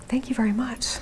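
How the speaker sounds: soft whispered thank-you. Real, not acted. Aside thanks to helper.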